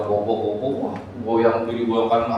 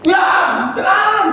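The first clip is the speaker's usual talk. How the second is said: high, loud yell; forceful mock bellow; shouted command; 6 dB louder, much slower than usual. Shown as a demonstration. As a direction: scream it to silence a storm. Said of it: "dia tidak teriak-teriak".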